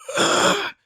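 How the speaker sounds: loud, high, strained gasp; pained gasp. Acted. Wounded Harlan lets out sound, loud strained gasp. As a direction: gasp out loudly in pain. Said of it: "lets out more sound".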